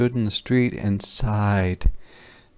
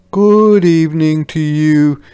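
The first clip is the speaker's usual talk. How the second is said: higher, louder greeting; polite soldiers; 7 semitones higher, 9 dB louder than usual. Acted. Soldiers at the door greeting Paul, raised polite voice.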